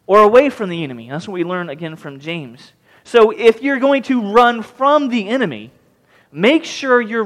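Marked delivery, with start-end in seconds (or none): 4.3-5.7 FROM leaned on, higher and drawn out; slow down and punch the word FROM; emphatic preacher's stress on FROM. Real, not acted. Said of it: "I emphasize those words".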